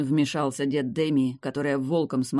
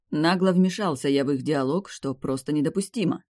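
plain reading voice

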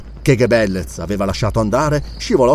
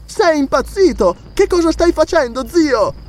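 put-on high-pitched outraged young woman; amazed, indignant. A narrator's reading. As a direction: protest to your uncle in disbelief. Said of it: "stupita e indignata".

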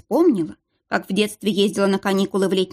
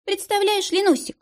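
cooing; 12 semitones higher than usual. A narrator's reading. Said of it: "проворковала Дарья".

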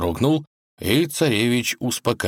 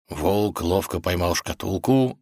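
ordinary delivery, nothing marked

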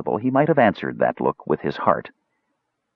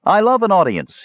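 high-pitched, pleased showman; satisfied smile; 13 semitones higher, 4 dB louder than usual. A narrator's reading. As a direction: relish it with an audible smile. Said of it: "said with a satisfied smile".

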